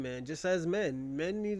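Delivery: ordinary talk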